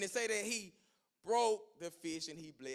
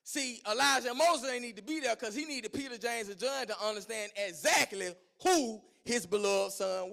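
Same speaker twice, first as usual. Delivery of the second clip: loud, higher preaching climb; preaching at full force; 4 semitones higher, 9 dB louder than usual. Acted. Preacher driving the point to the congregation: loud fervent preaching. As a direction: drive it home to the church.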